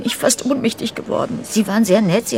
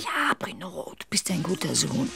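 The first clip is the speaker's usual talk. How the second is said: soft, lower whispered plea; 6 semitones lower, 10 dB quieter than usual. Real, not acted. Mother whispering to calm her yelling son.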